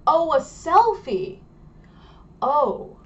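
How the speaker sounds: high, loud, slow oh of realisation; dawning realisation. Retold or imitated. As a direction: act out finally getting it. Foreigner realises the meaning.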